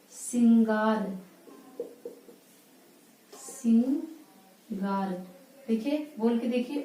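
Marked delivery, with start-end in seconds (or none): 0.1-5.3 सिंगार drawn out slowly with a nasal सिं; nasalized सिंगार, very slow; slow nasal vowel. Shown as a demonstration. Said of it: "नाक और मुख दोनों से निकल रहा है".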